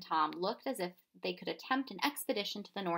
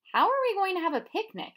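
put-on much higher, louder boy's voice; child's voice; 12 semitones higher, 9 dB louder than usual. A narrator's reading. Tom asks the professor about the picnic, in a high child's voice. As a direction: ask like a worried young boy.

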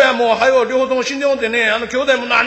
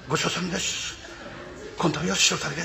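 small voice; 4 semitones lower, 10 dB quieter than usual. Shown as a demonstration. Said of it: "小さい声でな".

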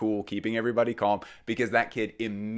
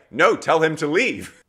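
demanding customer; 7 semitones higher, 7 dB louder, slower than usual. Retold or imitated. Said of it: "And she was like".

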